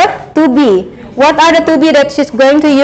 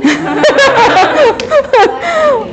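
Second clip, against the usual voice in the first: long high-pitched laugh; high giggling laugh; 9 semitones higher than usual. Real, not acted. Amused by her own remark. (tertawa geli bernada tinggi)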